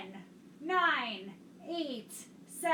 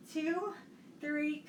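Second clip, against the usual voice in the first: normal talking voice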